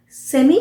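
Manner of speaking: सेमी ending in a short "ee": SEM-ee; British pronunciation. Shown as a demonstration. Teacher showing the simple variant, British pronunciation. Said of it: "British pronunciation है".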